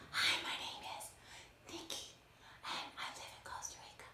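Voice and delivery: whispered, no voice at all. Shown as a demonstration. Teacher demonstrating a whisper to her young class. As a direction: breathe it out in a whisper. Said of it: "I was whispering".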